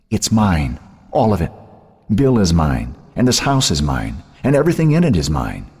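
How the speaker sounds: high-pitched taunting claim, lightly acted; possessive taunt. A narrator's reading. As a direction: lord it over your sister. Sister taunting her, claiming everything.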